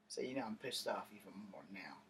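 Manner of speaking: low, hushed whisper of fresh anger. Real, not acted. Storyteller recalling being honked at, seething whisper. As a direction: breathe it out like seething under your breath. Angry aside told in whisper.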